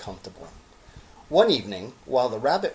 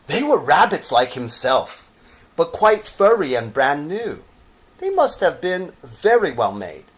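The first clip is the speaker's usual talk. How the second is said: higher, louder, bright storytelling; lively story narration; 7 semitones higher, 6 dB louder than usual. A narrator's reading. Reader telling children the exciting arrival, animated. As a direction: build excitement as you read.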